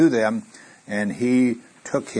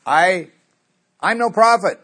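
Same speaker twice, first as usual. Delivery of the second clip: much higher, louder protest; defiant reply; 9 semitones higher, 7 dB louder than usual. Retold or imitated. Amos answering the priest, high and loud defiance. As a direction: fire back in defiance. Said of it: "He comes back and he says".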